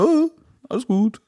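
höher, sorglos beruhigt; plötzlich fröhliches Baby. Retold or imitated. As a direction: als wäre nie was gewesen. Baby plötzlich wieder ruhig. (unbeschwert wie ein Baby)

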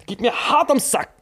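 ordinary delivery

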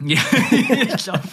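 laughing, high and slow. Real, not acted. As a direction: laugh through the words. Laughs at the GOAT joke. (lachend, hoch und langsam)

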